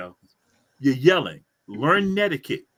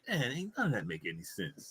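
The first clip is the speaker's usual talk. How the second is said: low and much softer, resigned; dejected mutter; 7 semitones lower, 14 dB quieter than usual. Retold or imitated. Giving up on his garbled voice text, quiet resigned mutter. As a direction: mumble it in defeat. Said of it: "I'm like".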